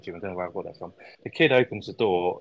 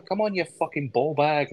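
a hint of a cheeky kid actor, pitched higher; mock swearing kid; 7 semitones higher than usual. Podcaster imitating a foul-mouthed kid in the film, lightly voiced. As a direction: toss the insult off like a mouthy twelve-year-old. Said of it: "He's like".

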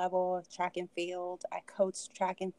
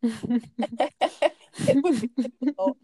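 real laugh, higher and louder; 4 semitones higher, 7 dB louder, much slower than usual. Real, not acted. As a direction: laugh your way slowly into the answer. Laughs while answering the question.

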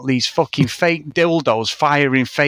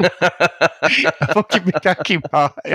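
laughing; 3 semitones higher, much slower than usual. Real, not acted. Laughing at his own story.

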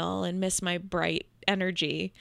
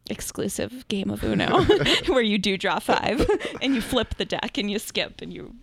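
louder, giggling through the words; laughing through words; 6 dB louder than usual. Real, not acted. Joking about an exclusive Uno game, laughing. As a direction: let the laughter spill over each phrase.

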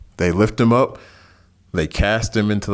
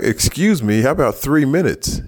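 mock indignant; 7 semitones higher than usual. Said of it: "I'm like".

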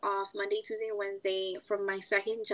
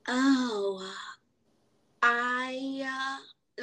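long pauses between drawn-out words; imitated halting pauses; much slower than usual. Retelling a coworker's talk, halting with long pauses. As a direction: mimic her hesitating, word by word. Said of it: "me doing these pauses".

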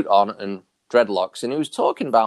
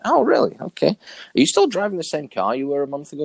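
higher-pitched, retold "oh really"; mock surprise; 6 semitones higher than usual. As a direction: act out questioning a doubtful friend. Questions friend complaining of no progress.

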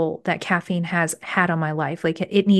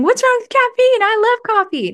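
much higher, louder mock protest voice; imagined coffee lover; 5 dB louder than usual. Imagined listener objecting to quitting coffee, high mock squeal. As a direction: squeal it like an outraged coffee fan. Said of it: "And you may be thinking".

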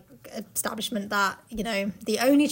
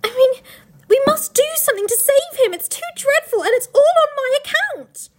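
much higher, louder desperate pleading; desperate girl; 13 semitones higher, 7 dB louder than usual. Acted. Lucy begging Mister Beaver to save Tumnus, high desperate plea. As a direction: beg like a frantic child. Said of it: "said Lucy".

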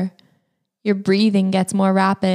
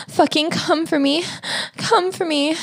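much higher, urgent pleading; high, desperate begging; 10 semitones higher than usual. Acted. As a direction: cry out, begging, pitched high. Begging at the climax.

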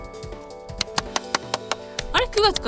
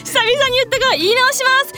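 high, loud, flustered announcement; flustered restart; 13 semitones higher, 7 dB louder than usual. Real, not acted. Host restarts after fumbling the address, flustered. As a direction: push through the slip in a high voice.

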